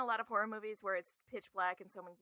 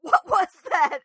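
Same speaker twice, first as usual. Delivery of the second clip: high, loud panicked questions, the repeat much louder; imitated freaking out; 9 semitones higher, 15 dB louder, slower than usual. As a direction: act out someone panicking in the dark. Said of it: "you just hear him freaking out".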